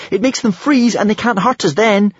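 high, urgent cry; shriek. A narrator's reading. Hiccup explains the trick to his friends, shrieking. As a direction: explain it in a high-pitched panic. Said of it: "shrieked Hiccup".

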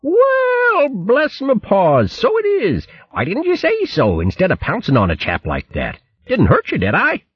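high, hearty upper-class hare voice; high plummy hare chap, amused; jolly old hare. Acted. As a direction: play the bluff, cheerful old soldier hare. Hare Bo's character voice.

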